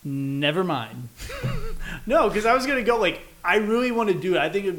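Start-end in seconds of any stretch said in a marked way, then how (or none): 1.1-2.0 high burst of laughter. Real, not acted. Laughs at his own mistake.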